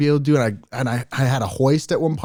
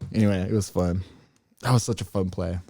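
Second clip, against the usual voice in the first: ordinary talk, nothing marked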